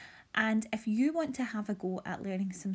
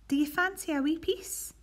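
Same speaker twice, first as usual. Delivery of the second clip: Sophie's mum offering; 8 semitones higher, 4 dB louder than usual. Acted. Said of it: "Sophie's mum said".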